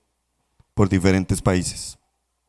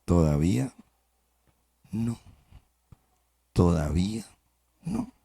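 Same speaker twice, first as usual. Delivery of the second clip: smiling master; 4 semitones lower, 8 dB quieter than usual. Acted. Smiling master softly refuses once more, low and soft. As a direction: gently hold the cup in the fire. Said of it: "El maestro sonreía y decía".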